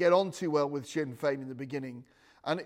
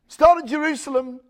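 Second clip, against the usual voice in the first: fire and brimstone preaching; 10 semitones higher, 11 dB louder than usual. Acted. Preacher to his congregation about spiritual war, loud and driving. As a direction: thunder the victory of Jesus over the room.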